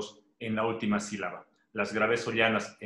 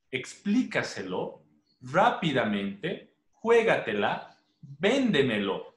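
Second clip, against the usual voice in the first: slow list, leaning on the accented syllable of each word, as in "véndemelo"; early-syllable stress; slower than usual. Shown as a demonstration. Teacher giving example words, slow and stressed early, as in "cuéntamelo". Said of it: "las que llevan la intensidad de voz".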